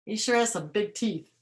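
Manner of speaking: ordinary delivery, nothing marked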